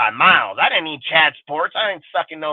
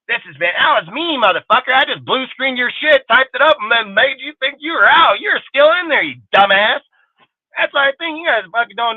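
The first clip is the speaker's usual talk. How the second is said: gloating rant; 8 semitones higher than usual. Real, not acted. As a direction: crow over the guy you hacked. Gloating about hacking them.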